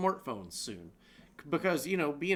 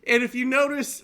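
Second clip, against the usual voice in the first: laughing; 8 semitones higher, 8 dB louder, slower than usual. Real, not acted. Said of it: "say this without laughing".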